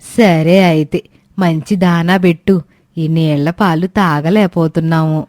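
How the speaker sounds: put-on old woman Suramma. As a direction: grumble like an impatient old granny.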